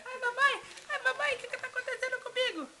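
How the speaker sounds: high, fast mock panic; mock distress. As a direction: ham up a silly panic for the baby. Playful mock distress to baby.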